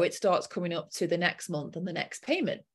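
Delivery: ordinary delivery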